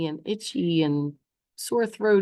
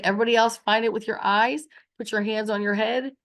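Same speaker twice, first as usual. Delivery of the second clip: usual speaking voice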